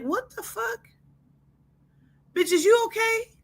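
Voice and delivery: squeaky high disbelief; shocked shriek. Acted.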